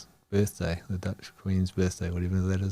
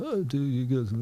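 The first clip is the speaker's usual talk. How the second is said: lightly mimicked self-righteous remark, higher pitched; a touch of mock superiority, pitched up; mildly self-righteous; 5 semitones higher than usual. Traveler mocking his own reaction to American excess, mildly self-righteous. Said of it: "a bit self-righteous".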